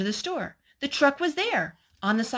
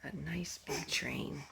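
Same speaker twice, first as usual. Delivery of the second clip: unvoiced, much softer whisper; whispered aside; 3 semitones lower, 13 dB quieter than usual. Real, not acted. Parent whispering about the toy train mid-story. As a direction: hush it so the story isn't broken.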